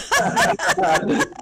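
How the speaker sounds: very high burst of laughter; amused laugh. Real, not acted. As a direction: crack up at a joke, high-pitched. Guest laughs at the senior joke.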